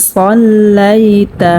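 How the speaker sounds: সল্লাইতা drawn out slowly with a heavy sound; recited Arabic. Shown as a demonstration. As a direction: chant সল্লাইতা slowly in Quranic recitation style. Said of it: "মোটা করিয়া পড়তে হবে". (সল্লাইতা ধীরে টেনে মোটা করে পড়া)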